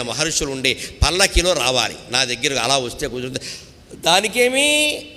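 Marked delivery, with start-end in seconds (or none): 4.0-5.0 arrogant drawl. Acted. Arrogant Nahusha voiced.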